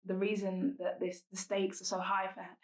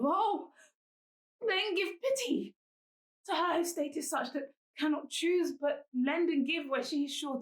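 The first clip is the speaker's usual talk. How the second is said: higher-pitched, pleading dramatic verse with weighted pauses; Helena, lovelorn confession; 6 semitones higher than usual. Acted. Commoner confessing love to the madam whose son she loves, pleading.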